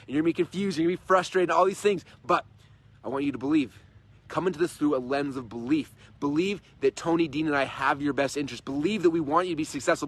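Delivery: normal talking voice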